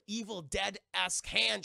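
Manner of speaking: normal talking voice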